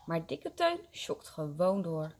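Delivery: ordinary delivery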